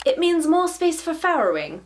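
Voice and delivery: high-pitched, put-on posh British reading; posh British accent. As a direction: read it aloud as an upper-class British writer. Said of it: "my poshest British accent".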